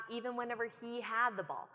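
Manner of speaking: usual speaking voice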